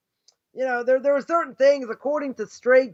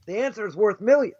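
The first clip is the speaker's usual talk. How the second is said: usual speaking voice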